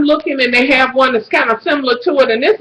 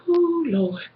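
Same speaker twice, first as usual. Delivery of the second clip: soft, high little swoon; hushed craving; 6 semitones higher, 10 dB quieter than usual. Retold or imitated. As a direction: quietly swoon over food, acted out. Craving syrup while shopping.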